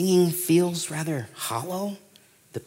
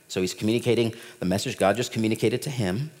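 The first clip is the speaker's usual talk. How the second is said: ordinary talk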